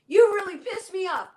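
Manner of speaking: voicing a child's blurted anger, high and loud; mock angry outburst. Child snapping at family, mock angry outburst. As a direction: play the kid snapping back at a parent.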